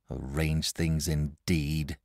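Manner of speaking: slow, soft bitter mutter. A narrator's reading. Boy bitterly muttering on the way home. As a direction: say it sourly to yourself. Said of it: "he said bitterly to himself".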